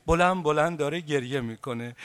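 usual speaking voice

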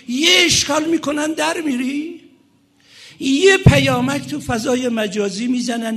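high, loud indignant sermon; impassioned preaching. Real, not acted. Preacher rousing young believers who waver, impassioned.

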